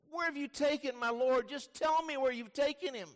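high, urgent plea; pleading Mary. Acted. Weeping Mary begs the supposed gardener, high acted plea.

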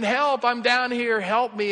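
mock cry for help, pitched high; drawn-out call for help, imitating a stranded man; retold yell. Fallen man calls up the cliff for help, retold yell. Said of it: "And he yells out again".

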